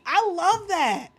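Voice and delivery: high, drawn-out delight. Real, not acted. Streamer reacting to viewer's Hogwarts joke, delighted and high. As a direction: squeal your approval, slowly.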